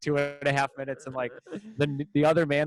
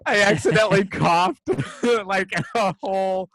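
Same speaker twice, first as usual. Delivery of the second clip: higher, laughing through the words; 3 semitones higher than usual. Real, not acted. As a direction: giggle out the embarrassing story. Laughing retelling coffee spit.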